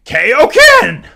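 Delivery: high, loud yell that cracks. Acted. Streamer yelling Kaioken, voice cracking. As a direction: yell a battle cry until the voice cracks. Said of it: "I got a voice crack".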